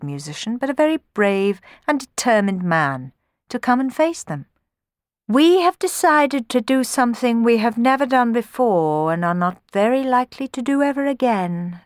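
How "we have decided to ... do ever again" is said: Furies voice with a hint of a rusty smile; grudging kindness, a touch pitched up; lightly voiced Furies, half smiling. A narrator's reading. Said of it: "smiling rather rustily".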